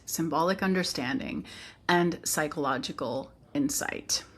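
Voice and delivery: usual speaking voice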